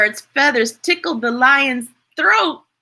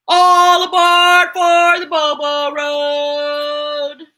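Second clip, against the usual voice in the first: announced title; 2 semitones higher, 4 dB louder, slower than usual. Acted. Reading the title with flourish.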